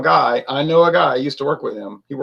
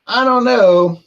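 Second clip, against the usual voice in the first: high, louder musing; doubtful inner thought; 7 semitones higher, 6 dB louder, slower than usual. Retold or imitated. Thinking to himself while fiddling with things, doubtful. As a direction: think it aloud, pitched up and doubtful. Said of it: "I'm thinking to myself".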